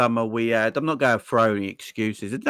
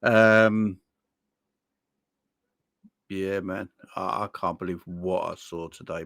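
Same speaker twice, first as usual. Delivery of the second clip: ordinary talk, nothing marked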